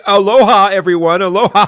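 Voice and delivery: loud jeering aloha while laughing; sarcastic laughing greeting; mocking aloha. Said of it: "you put up your middle finger".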